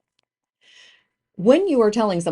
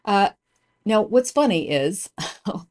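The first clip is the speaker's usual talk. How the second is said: ordinary talk, nothing marked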